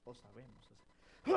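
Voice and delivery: ordinary talk, nothing marked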